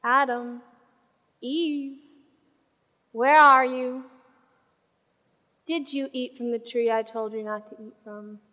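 hushed, grave questioning; God. Acted. God calls to hiding Adam and Eve, hushed and sorrowful. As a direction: speak very softly, as if let down. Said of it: "you can hear it in his voice".

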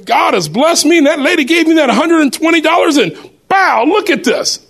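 high, thrilled bragging; retelling own giddy boast; excited boast. Retold or imitated. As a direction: show off the blessing, giddy and high. Boasting about the gift watch.

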